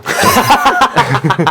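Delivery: bright teasing laugh. Real, not acted. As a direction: burst out laughing.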